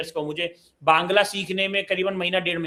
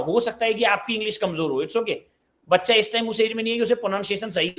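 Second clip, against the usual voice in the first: ordinary talk, nothing marked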